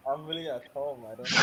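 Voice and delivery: faint, far-off quiet voice; very low. Real, not acted. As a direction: answer very softly, barely audible. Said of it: "you are speaking very, very low".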